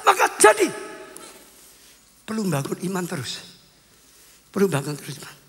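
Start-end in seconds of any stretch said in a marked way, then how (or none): 0.1-0.7 high, firm declaration with falling ends; emphatic pronouncement. Retold or imitated. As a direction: pronounce the reward of great faith. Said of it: "Perwira itu". (sabda tegas bernada tinggi)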